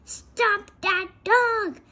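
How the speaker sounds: high, loud and slow shout. Acted. King shouting at the crowd to stop the dog. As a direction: yell after the runaway dog. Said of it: "shouted the king".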